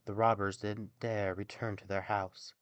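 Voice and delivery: ordinary delivery, nothing marked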